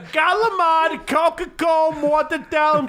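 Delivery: high, hammed-up Italian-American holler; mock Italian-American accent. Podcaster derails the bar story, mock Italian-American accent.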